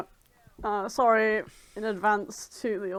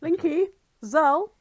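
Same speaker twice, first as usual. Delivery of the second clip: teasing nicknames; 6 semitones higher, 7 dB louder, slower than usual. Acted.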